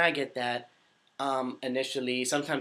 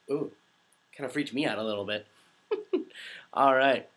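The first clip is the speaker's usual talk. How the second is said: ordinary delivery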